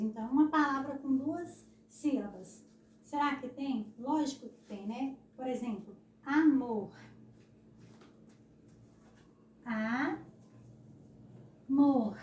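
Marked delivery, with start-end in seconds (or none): 6.2-12.2 amor loud, broken into a, long pause, MOR; syllables separated. Shown as a demonstration. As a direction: split amor as a ... mor, pushing the last syllable. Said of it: "É assim que se separa a palavra amor". (amor separado em sílabas, com força)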